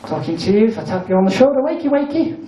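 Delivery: normal talking voice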